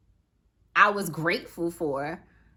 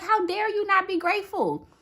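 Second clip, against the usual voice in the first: higher, insistent scolding of herself; stern self-scolding; 10 semitones higher than usual. Retold or imitated. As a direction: lecture yourself, pitch up and press the words. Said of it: "I was like".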